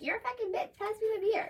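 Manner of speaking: put-on very high, girly sassy voice; sassy nail-emoji. Boyfriend talking like one of the girls, high sassy tease. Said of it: "like, with the nail emoji".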